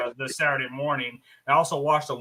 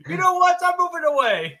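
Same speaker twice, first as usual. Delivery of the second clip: much higher, cartoonish kid voice; high silly voice; 5 dB louder than usual. Rome answers the teacher.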